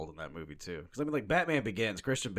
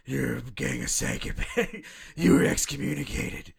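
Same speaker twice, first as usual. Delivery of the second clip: slow, loud, running out of air; hammed-up breathy menace; Batman voice; 5 semitones higher, 5 dB louder, slower than usual. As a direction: push each word out like a winded Batman. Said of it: "the way he says psychopaths".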